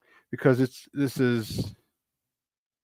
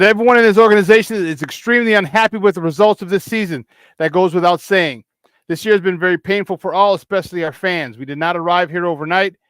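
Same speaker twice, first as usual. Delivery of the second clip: raised, louder formal read of a press statement; reading an official statement; 4 semitones higher, 3 dB louder than usual. Acted. Host reading the owner's season statement to viewers, formal read.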